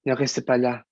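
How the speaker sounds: "Ne restez pas là" with a strong untrained foreign accent; "Ne restez pas là" said as a foreigner who hasn't worked on the accent; "Ne restez pas là" in a thick accent, the way the teacher objects to; heavy foreign accent. Shown as a demonstration. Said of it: "il faut que tu travailles ton accent".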